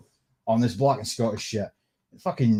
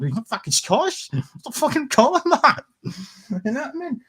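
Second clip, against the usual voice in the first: exasperated laugh; 13 semitones higher than usual. Real, not acted. Ranting about Scottish label.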